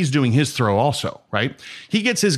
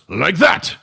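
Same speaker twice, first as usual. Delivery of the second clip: high, punched-out master's exclamation; Jihan Jay; 5 semitones higher, 4 dB louder than usual. Acted. Grandmaster after a flawless demonstration throw, emphatic exclamation. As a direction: show them up with a triumphant call. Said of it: "and he goes".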